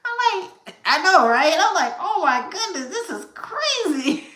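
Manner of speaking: high squeaky laughing-crying talk. Retold or imitated. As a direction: squeal it through helpless laughter. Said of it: "And I just cried".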